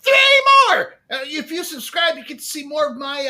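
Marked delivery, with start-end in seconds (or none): 0.0-0.9 high, loud rally cry; excited shout. Real, not acted. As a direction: whip the chat up for more subs. Hyping chat for subs.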